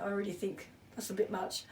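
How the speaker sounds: chuckling through the words; laughing. Real, not acted. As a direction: say it with a soft laugh. Joking aside about star jumps.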